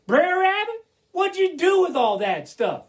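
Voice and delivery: high, loud audience-member question; curious listener. Acted. Somebody in the audience asking Brer Rabbit, high and curious. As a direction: pitch it up as an eager questioner. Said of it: "somebody here is gonna say".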